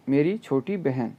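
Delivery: usual speaking voice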